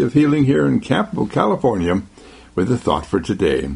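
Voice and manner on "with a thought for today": normal talking voice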